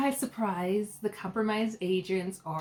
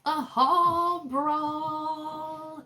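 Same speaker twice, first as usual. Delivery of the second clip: drawn-out announcer; 6 semitones higher, much slower than usual. Acted. Announcing the challenge dramatically.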